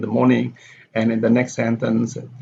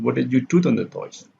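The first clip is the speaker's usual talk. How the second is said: "do" stressed, tune falls to "toys"; falling on do. Shown as a demonstration. Teacher demonstrates falling intonation, on "do". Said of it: "do here is the following intonation".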